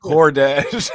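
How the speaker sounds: loud, slow, through real laughter; cracking up. Real, not acted. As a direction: stretch the pun out while laughing. Cracking up at the pun.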